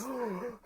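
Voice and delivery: a high, sudden gasp of alarm; shocked gasp. Retold or imitated. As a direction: gasp as if you just spotted the drowning kid. Lifeguard notices drowning boy.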